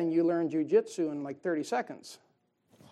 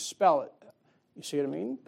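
ordinary delivery

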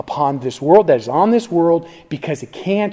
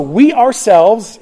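"we ourselves" drawn out slow, high and loud; stress on OURSELVES; 5 semitones higher, 5 dB louder, much slower than usual. Shown as a demonstration. Preacher reading the emphatic pronoun to the congregation, stress on ourselves. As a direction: punch "we ourselves" slowly, raised and forceful.

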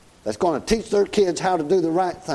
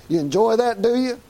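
higher, teasing challenge; mock question; 6 semitones higher than usual. Real, not acted. Preacher teasingly challenging the congregation about spanking. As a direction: needle them with it.

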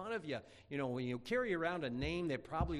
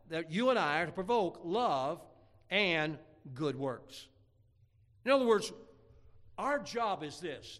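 raised, emphatic pulpit delivery; preaching; 5 semitones higher, 5 dB louder than usual. Real, not acted. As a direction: drive the point home to the congregation. Preaches on the verse.

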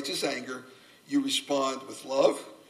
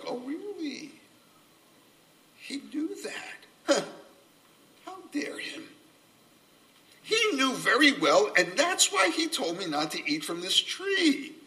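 a hint of Eve, high and outraged; indignant Eve; 10 semitones higher than usual. Preacher voicing Eve's outrage at God. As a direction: scoff as Eve, betrayed and indignant.

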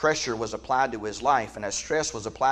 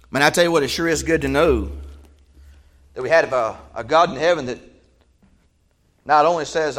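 normal talking voice

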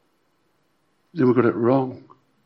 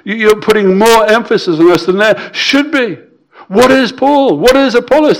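Paul pleading, rising higher toward the end; voicing Paul; 6 semitones higher than usual. Preacher voicing Paul rebuking the church, pleading higher. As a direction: plead as Paul, pitch climbing. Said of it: "This is what he's underlining".

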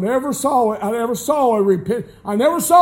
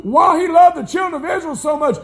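preaching at full force; 4 semitones higher than usual. Real, not acted. Pastor to congregation, full-force preaching. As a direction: build the sermon to a ringing climax.

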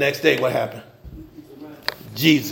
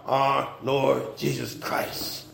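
slow reverent reading; 9 semitones lower, 10 dB quieter, much slower than usual. Acted. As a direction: stretch each word out slowly and softly. Reading scripture slowly.

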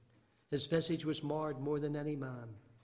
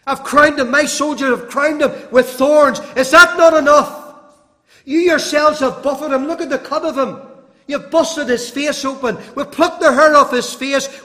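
much higher, much louder anguished shout; imagined Pilate pleading; 10 semitones higher, 20 dB louder than usual. Retold or imitated. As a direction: Pilate crying out to the crowd in exasperation. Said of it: "he was saying something like this".

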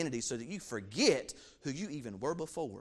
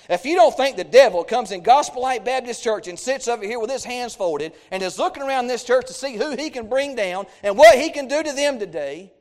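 loud, higher fiery preaching; preaching shout; 5 semitones higher, 12 dB louder than usual. Real, not acted. Preacher warning the church about the devil, full shout. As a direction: thunder it at the congregation.

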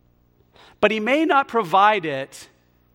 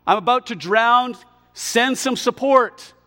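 high, drawn-out plea to God; crying out; 5 semitones higher, 4 dB louder, slower than usual. Acted. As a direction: cry out for rescue, slowly. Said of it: "he cries out to God".

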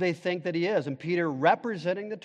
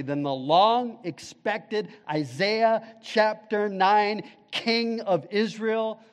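higher, slow, building preacher cadence; preaching at full force; 4 semitones higher, 4 dB louder, slower than usual. Acted. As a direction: drive it home to the congregation. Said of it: "now listen carefully".

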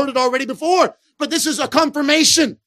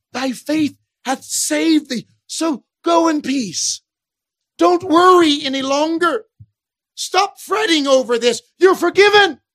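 reassuring Jesus; 6 semitones higher, 5 dB louder than usual. Acted. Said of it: "And again".

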